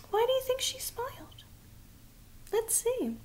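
hushed, high aside; soft aside to child. Acted. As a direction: gently ask the little listener. Asks child listener softly.

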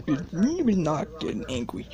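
self-calming. Real, not acted.

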